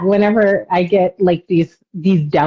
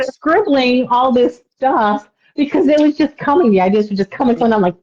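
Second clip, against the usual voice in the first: normal talking voice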